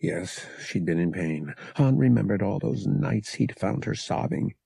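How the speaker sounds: ordinary delivery, nothing marked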